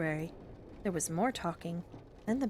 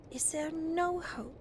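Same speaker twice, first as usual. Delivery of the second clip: slow, drawn-out anxious question, pitched high; low, unsteady voice; 9 semitones higher, slower than usual. A narrator's reading. Peter asks Father about the broken engine, low and unsteady. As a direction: ask it slowly with a touch of worry. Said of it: "in a low, unsteady voice".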